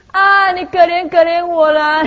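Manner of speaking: high, slow mock plea after a long "啊"; struggling begging child. Beggar child failing to recite, drawn-out high plea. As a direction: stretch the "啊", then slip into begging. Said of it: "那个小孩子啊了半天".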